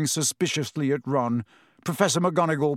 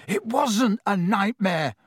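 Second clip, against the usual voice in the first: high, drawn-out protest; mild yell of protest; 7 semitones higher, slower than usual. A narrator's reading. Ron contradicting his brother, mildly yelled. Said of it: "Ron yelled".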